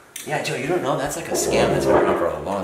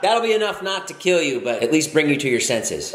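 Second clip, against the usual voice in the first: high-pitched mock cry; feminine cry; 7 semitones higher, 3 dB louder than usual. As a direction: squeal out a girly cry. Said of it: "what a feminine cry".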